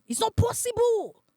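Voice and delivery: very high, loud frantic denial; a boyfriend rejecting the news, frantic and high; boyfriend's denial. Retold or imitated. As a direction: deny it in a panicked high voice. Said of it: "I saw a different person".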